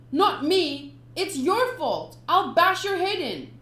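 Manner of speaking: put-on a kid lashing out; angry boy. Sanji blaming the pirate, loud angry yell.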